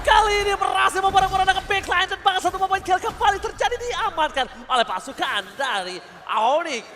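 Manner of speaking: loud hype play-by-play; esports caster. Real, not acted. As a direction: shout the teamfight call. Caster yells live teamfight.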